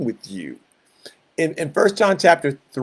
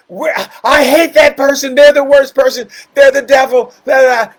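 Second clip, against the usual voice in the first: much louder and higher hateful shout; hateful crowd; 9 semitones higher, 9 dB louder than usual. Retold or imitated. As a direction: yell like a mob worked up into hate.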